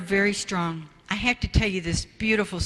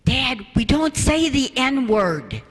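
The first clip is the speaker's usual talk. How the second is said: lightly voiced daughter, higher and slower; chiding daughter; 5 semitones higher, 4 dB louder, slower than usual. Daughter correcting her father in front of the child, mock prim. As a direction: correct Dad gently but firmly. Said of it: "Jennifer's standing behind the child ... going".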